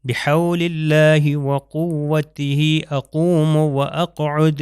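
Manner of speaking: slow careful prayer recitation. Shown as a demonstration. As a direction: recite slowly, hit each ق from the throat. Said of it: "یہاں پر آپ دیکھیے یہ قاف".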